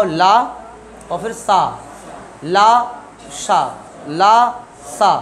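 ला and सा drawn out slowly, each sound separate; demonstrating ला and सा. Shown as a demonstration. Teacher showing the class how ला and सा sound. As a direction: say ला then सा slowly and clearly. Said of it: "तो इसको बोल के दिखाओ".

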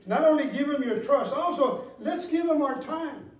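loud, driving pulpit delivery; full-force preaching. Acted. Pastor pressing the church to give time, loud preaching. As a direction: preach it hard to the pews.

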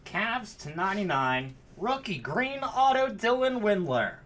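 high, loud announcer-like hype; real thrilled holler; excited card call. Real, not acted. Calling out a big card pull.

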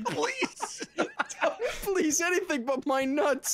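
laughing. Pretend. Mock begging, like a dog at vet.

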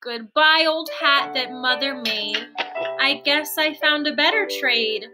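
ordinary delivery

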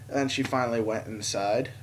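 normal talking voice

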